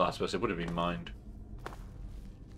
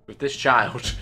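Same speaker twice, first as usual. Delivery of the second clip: mock heroic cry; 3 semitones higher, 9 dB louder, slower than usual.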